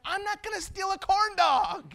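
high-pitched protest; hammed-up outburst of conscience; mock indignation. Dad realizes he is about to steal, high mock protest. Acted alarm at his own thought.

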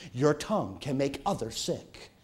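ordinary talk

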